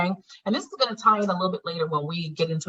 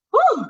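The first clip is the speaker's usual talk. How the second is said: high whew; 7 dB louder than usual. Real, not acted. Admitting she gets angry.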